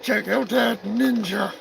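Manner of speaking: very high, cartoonish sidekick voice barging in; high-pitched puppet heckling the host; squeaky puppet voice. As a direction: pipe up like a cheeky little puppet. Turkey Surprise plugs his movie.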